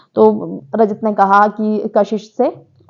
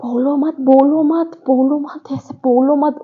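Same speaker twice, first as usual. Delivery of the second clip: higher-pitched mock hushing; imitating Chahat Pandey; 4 semitones higher, slower than usual. Contestant telling her ally not to say it, mock hushing. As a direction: imitate someone urgently shutting a friend up. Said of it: "चाहत पांडे क्या बोलती है".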